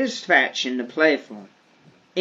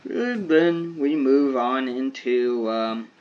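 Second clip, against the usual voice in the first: yawning. Real, not acted. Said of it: "Apologize for the yawn".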